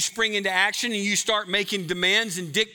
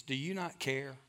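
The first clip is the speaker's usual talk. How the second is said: "Do you not care?" said much softer, hushed and inward; quiet plea; 4 semitones lower, 10 dB quieter than usual. Retold or imitated. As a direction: ask it softly, almost to yourself. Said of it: "we ask that same question of Jesus".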